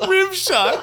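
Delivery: very high, squealed through laughter; laughing hard. Real, not acted. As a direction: crack up at your own slip. Cracking up at own slip.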